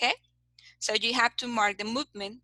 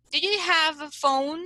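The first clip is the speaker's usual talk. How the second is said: higher-pitched question, lifting on "phone"; rising question intonation; 4 semitones higher than usual. Shown as a demonstration. Teacher shows students a question with rise intonation, rising on "phone". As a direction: ask it with raised intonation on "Do you have a phone". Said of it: "a raised intonation. So you say".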